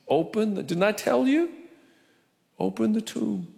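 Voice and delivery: a touch of impatient Jesus; Jesus insisting. Retold or imitated. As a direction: push the order through a bit exasperated.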